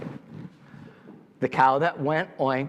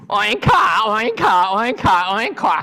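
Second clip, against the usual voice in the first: loud, raised oinks broken by ha's; laughing pigs; 3 semitones higher, 5 dB louder, slower than usual. A narrator's reading. Storyteller plays pigs laughing at the pig that went moo, mocking oink-laughs. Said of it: "The pigs that went oink laughed".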